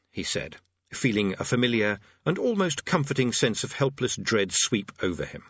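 usual speaking voice

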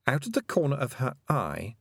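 ordinary delivery

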